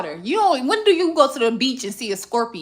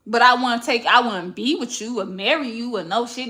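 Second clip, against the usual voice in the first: usual speaking voice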